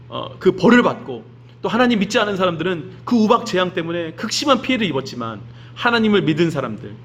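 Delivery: usual speaking voice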